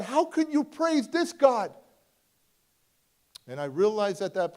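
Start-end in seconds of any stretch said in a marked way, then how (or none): 0.0-1.7 much higher, slow, stunned disbelief; incredulous. Retold or imitated. Questioning the father's faith, incredulous cry. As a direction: ask it as if it makes no sense.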